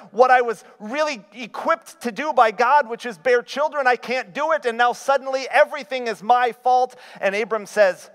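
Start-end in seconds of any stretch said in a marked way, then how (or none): 0.1-6.9 worked-up venting. Retold or imitated. Preacher voices Sarai's frustration.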